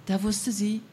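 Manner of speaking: plain reading voice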